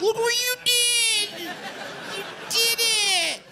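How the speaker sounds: put-on squeaky baby talk to a dog; high, slow coo at the pet; gushing pet voice.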